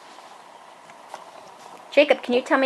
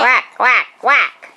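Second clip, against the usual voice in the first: louder playful quacking; duck quack; 4 dB louder, slower than usual. Shown as a demonstration. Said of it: "What about a duck?".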